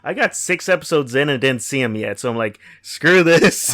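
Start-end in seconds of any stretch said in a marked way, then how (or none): 2.8-3.7 louder exasperated outburst; fed-up, punchy retort. Retold or imitated. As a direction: give up in exasperation. Quotes own exasperated reaction.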